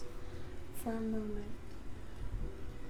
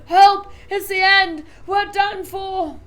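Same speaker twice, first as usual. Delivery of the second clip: loud, higher cry for help; terrified shout; 6 semitones higher, 11 dB louder, slower than usual. A narrator's reading. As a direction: yell in panic. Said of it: "Grandpa Joe shouted".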